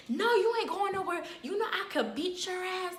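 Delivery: high, mocking threat as the auntie; imitating a threatening aunt, pitched high. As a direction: pitch up to play the bossy aunt. Auntie voiced threatening narrator.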